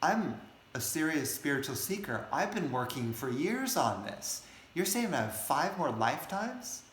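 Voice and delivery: high, indignant protest; acted angry protest. Spiritual seeker arguing with the sage, acted angry protest. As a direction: protest in offended disbelief, pitch swooping up. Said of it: "he gets really angry at the sage".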